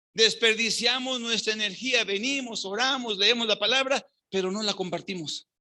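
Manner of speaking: ordinary talk, nothing marked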